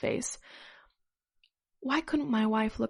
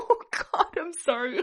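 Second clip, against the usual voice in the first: real high-pitched laughing fit; cracking up; 3 dB louder than usual. Real, not acted. Reader losing it at the story and apologizing, real laughter. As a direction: break down laughing through an apology.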